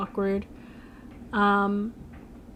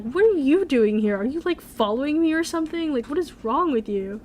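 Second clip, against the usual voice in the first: higher-pitched indignant confrontation, acted out; mad; 5 semitones higher than usual. Girl confronting the guy she thinks is following her, mad. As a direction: snap at him in mock outrage, pitch jumping up. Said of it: "she gets mad".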